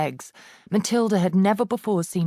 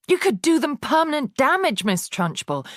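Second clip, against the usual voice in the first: much higher distressed plea; alarmed cry; 9 semitones higher than usual. A narrator's reading. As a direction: cry out in protest. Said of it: "Miss Honey cried out".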